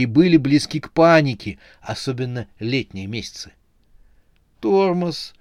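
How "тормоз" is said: high, sinking, deflated reply; crestfallen. A narrator's reading. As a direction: let the word droop in defeat. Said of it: "упавшим голосом проговорил Седобородый". (упавшим голосом)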